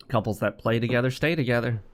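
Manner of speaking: ordinary talk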